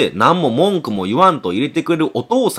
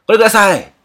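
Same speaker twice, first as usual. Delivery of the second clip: higher, louder eager calls; customers calling out; 10 semitones higher, 6 dB louder, faster than usual. Retold or imitated. Shoppers crowding the stall call out eagerly for the product. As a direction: shout over the crowd to buy.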